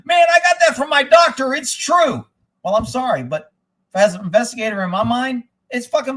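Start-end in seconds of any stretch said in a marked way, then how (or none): 0.1-2.3 high, louder mocking voice for the texter; mock reply. Reading his buddy's text reply in a high mocking voice. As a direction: hams up the buddy's earnest reply. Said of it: "And a guy wrote back".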